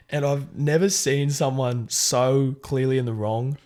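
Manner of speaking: ordinary talk, nothing marked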